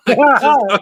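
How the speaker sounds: words through a burst of laughter; real laugh breaking the words. Real, not acted. Cracking up at the story.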